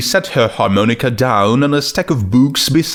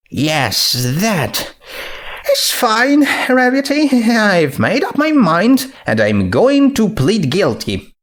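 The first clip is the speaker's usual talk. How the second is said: Twilight voice; 10 semitones higher than usual. Acted.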